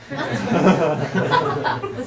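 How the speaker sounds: laughing it out, slow and loud; amused laugh through the words; giggling about Nordstrom's. Real, not acted.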